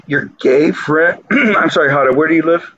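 ordinary talk, nothing marked

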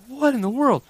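high-pitched baffled exclamation; retold astonishment. Dad seeing blood everywhere, retold astonishment.

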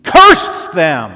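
sudden explosive shout; yelled curse. Acted. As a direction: yell it out with full force, high and loud. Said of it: "He could have yelled out".

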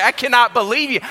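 high, loud disbelief at a friend; mock indignant. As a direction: scold him in hammed-up shock. Confronts pastor about the prank.

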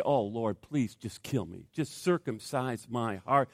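low, soft plea to God; murmured pleading; hushed prayer. Retold or imitated. Voices his daily prayer.

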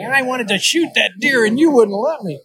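high, mock complaining voice; a hint of a sulky grown daughter; aggrieved daughter. Daughter still complaining to Dad years later, mock sulky. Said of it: "It's like".

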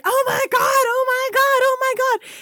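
high, loud near-crying yell; panicked shriek. Retold or imitated. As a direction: shriek it over and over, losing it. Retold freak-out in the elevator.

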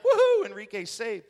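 loud, high whoop; hammed-up victory cheer; mock celebration. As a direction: whoop it up for a happy ending. Imagined celebration at story's end.

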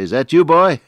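high, loud questioning call; imitated father calling. Blind father hearing his son at the doorway, imitated calling out. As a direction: call out as an old father across a room.

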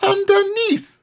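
very high, squeezed-out little voice; tiny high character voice; small squeaky Piglet. A narrator's reading. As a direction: squeak it as if pinned underneath. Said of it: "said Piglet in an underneath sort of way".

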